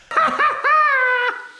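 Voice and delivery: high, loud giggly sinister cackle; evil clown laugh. Acted. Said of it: "sorry about the evil clown laugh".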